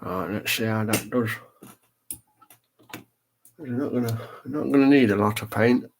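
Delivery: talking with a brush in mouth; mouth full. Real, not acted. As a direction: brush clenched in teeth. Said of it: "I sound funny 'cause I've actually got a brush in my mouth".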